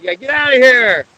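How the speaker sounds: high, loud playful yell; rising mock-bullying shout; shooing away. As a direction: holler to chase an animal off. Bullying a sea lion away.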